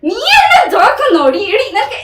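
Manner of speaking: loud, harsh scolding; angry shout. Acted. Husband shouting at wife for calling her family. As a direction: berate her at full voice.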